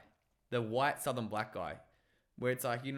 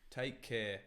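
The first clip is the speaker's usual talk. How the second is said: English accent demo; 4 semitones lower, 7 dB quieter than usual. Shown as a demonstration. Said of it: "could you do English?".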